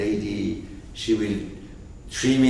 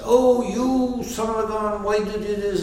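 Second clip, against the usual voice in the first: furious dog; 10 semitones higher, 4 dB louder than usual. Acted. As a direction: stretch out the outraged complaint, high. Very angry dog.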